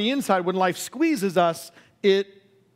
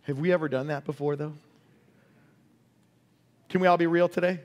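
ordinary delivery

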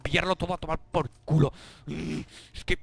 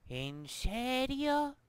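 sarcastic drawl; 8 semitones higher, 4 dB quieter, much slower than usual. Real, not acted. Sarcasmo lento.

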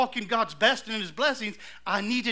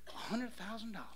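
much softer, almost under the breath; hushed awe; 12 dB quieter than usual. Retold or imitated. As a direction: drop to a stunned hush. Stunned at the check's amount.

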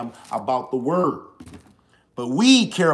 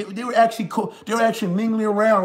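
ordinary delivery

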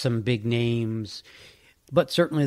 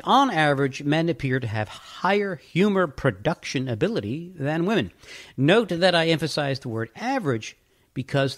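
quoted reading; 4 semitones higher than usual. Shown as a demonstration. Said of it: "I'll just continue this, this quote here".

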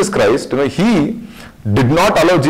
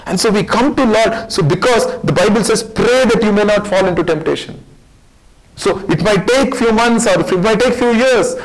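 high, raised preaching voice pressing the crowd; fervent preaching; 7 semitones higher than usual. Acted. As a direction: preach it out with urgency. Preaching at full force.

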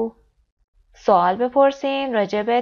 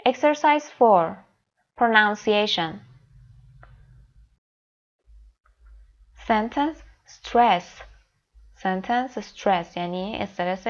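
slow, deliberate reading of headings; slow textbook read; much slower than usual. Shown as a demonstration. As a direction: read each heading out carefully. Reads textbook headings slowly.

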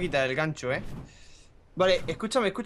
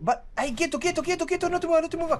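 high, urgent commands; raging gamer; 10 semitones higher than usual. Real, not acted. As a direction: bark stop orders at your teammate. Urgent orders mid-game.

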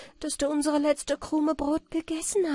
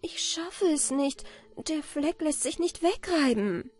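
higher-pitched, mildly discouraged voice; weary, giving up; 8 semitones higher than usual. A narrator's reading. Anna Barbara cannot rub the stain away, mildly weary. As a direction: give up with a touch of weariness. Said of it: "ließ sie müde die Arme sinken und sprach".

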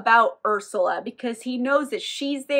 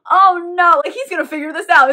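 higher, louder, fast mock alarm; hammed-up dread, quick and loud; mock panic; 5 semitones higher, 8 dB louder than usual. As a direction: rush it out like a half-joking worried aside. Narrator foresees the trick backfiring.

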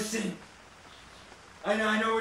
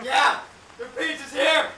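high, raised delivery holler; calling out loudly; 5 semitones higher, 4 dB louder than usual. Pretend. Pizza deliverer announcing himself in a creepy house, calling out.

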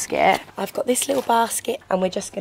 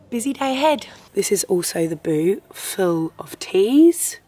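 ordinary talk

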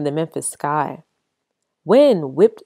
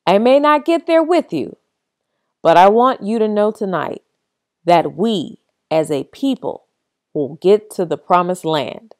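booming preacher; 2 semitones higher, 4 dB louder than usual. Acted. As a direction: ring it out like a sermon to a crowd. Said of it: "His voice boomed".

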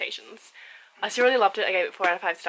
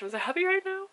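much higher happy squeal; excited squeal; 11 semitones higher than usual. Real, not acted. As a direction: squeal it out with joy. Repeated gush after box.

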